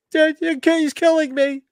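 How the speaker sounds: very high, put-on victim's wail; mock horror victim. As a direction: play a slasher victim in falsetto. Acting out a slasher victim.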